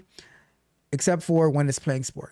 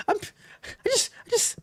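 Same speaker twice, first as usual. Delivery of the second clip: mock pouting. Host mimicking friend admitting he wanted promotion, mock pout. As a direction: imitate a sulker reluctantly owning up. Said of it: "You kind of are pouting".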